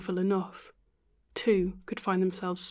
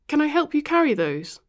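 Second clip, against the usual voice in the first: raised, eager offer; 8 semitones higher, 8 dB louder than usual. A narrator's reading. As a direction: call it up the stairs. Offering to carry groceries.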